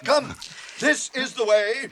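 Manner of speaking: loud, high call to the others; rally them forward with a shout. Acted. Gandalf calling the company on, loud and high. Gandalf leads through dark mines.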